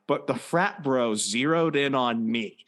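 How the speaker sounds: ordinary talk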